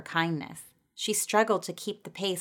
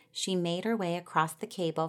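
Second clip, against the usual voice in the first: ordinary delivery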